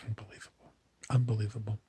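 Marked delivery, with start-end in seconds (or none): 0.0-0.7 much softer, voiceless whisper. Shown as a demonstration. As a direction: breathe the word Unbelievable with no voice at all. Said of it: "Why am I saying unbelievable?".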